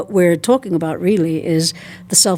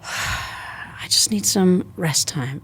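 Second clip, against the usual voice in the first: very slow, softer, after a sigh; 6 dB quieter, much slower than usual. Shown as a demonstration. The body answering after a sigh, very slow and soft. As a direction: let the body's need come out slowly.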